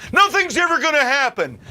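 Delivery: a hint of a whiny complainer, high and loud; mocking complainer. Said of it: "you bitch and moan".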